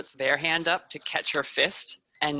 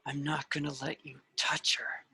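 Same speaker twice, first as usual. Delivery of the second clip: whispered, softer vow; defiant whisper; 7 dB quieter than usual. Acted. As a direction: breathe the promise through the fear. Aiden defying Wrath face to face.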